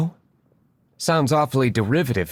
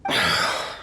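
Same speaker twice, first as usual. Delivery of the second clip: weary sigh; 2 semitones lower than usual. Acted. Diluc sighs at the nickname.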